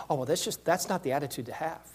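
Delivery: prim churchy. Retold or imitated. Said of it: "you're thinking".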